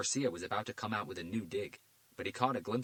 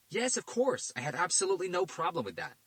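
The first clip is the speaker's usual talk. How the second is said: a pushy detective, voice pitched higher and louder; Garcia's voice; 11 semitones higher, 7 dB louder than usual. A narrator's reading. Detective Garcia demanding answers from the FBI director, pushy.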